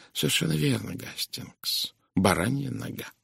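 soft, half-whispered reply; hushed confirmation. Acted. Detective confirming his friend's guess, hushed. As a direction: drop almost to a whisper as you confirm.

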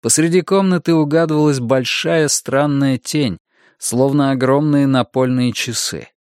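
plain reading voice

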